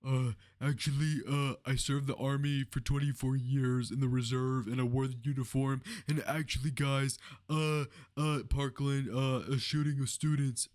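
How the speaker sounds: a hammed-up mock of Walz dodging the question; mocking Coach Walz. Streamer mocks Walz's evasive answer in a dopey voice. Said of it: "this Coach Walz person is like".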